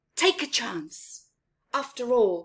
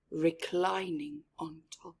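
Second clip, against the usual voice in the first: whisper; 4 semitones lower, 6 dB quieter than usual. A narrator's reading. Slumbering lion, hushed.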